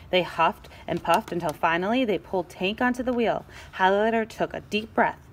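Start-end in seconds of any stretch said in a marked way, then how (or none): none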